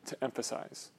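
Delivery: whispered close to the phrase; breathy trailing whisper; hushed ending. Acted. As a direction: let the last words fall to a whisper. Quote's closing words dropped away.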